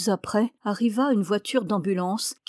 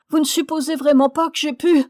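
high, loud appalled question; aghast young woman, pitched way up; distraught protest; 7 semitones higher, 7 dB louder than usual. A narrator's reading. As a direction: plead in disbelief, voice raised.

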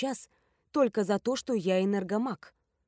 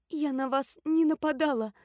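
usual speaking voice

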